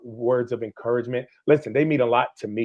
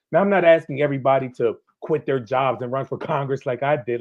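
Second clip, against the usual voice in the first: ordinary talk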